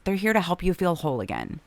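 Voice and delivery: ordinary talk, nothing marked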